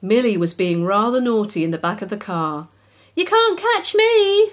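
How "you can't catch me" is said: very high, slow squeaky mouse taunt; squeaky cheeky mouse. A narrator's reading. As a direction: tease the dog in a tiny high voice. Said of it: "she cried cheekily".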